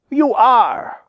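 high, angry storybook retort; indignant fox's high comeback; raging cry. A narrator's reading. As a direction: cry it out in mounting rage. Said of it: "cried Granny, working herself into a great rage".

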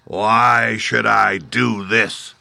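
slow, loud, booming demand; demanding beast. Acted. Beast Oz demanding of the Woodman, slow and loud. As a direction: drag the question out like a beast. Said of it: "demanded the beast".

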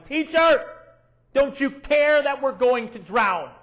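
high, loud, desperate cry; panicked shout. Retold or imitated. Disciples shouting to the sleeping Jesus, panicked. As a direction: cry out like men about to drown. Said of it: "woke him up shouting".

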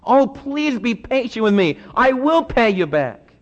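lightly acted desperate begging. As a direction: beg for patience, voice lifted. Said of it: "begged him".

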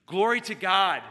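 rousing preacher's exclamation; preaching praise. Real, not acted. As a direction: ring out the closing praise. Closing praise of the eulogy.

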